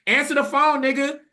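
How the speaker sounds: loud high yell at the gate; frantic yell to an unanswering brother. Retold or imitated. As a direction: shout it at the closed gate. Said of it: "I'm yelling".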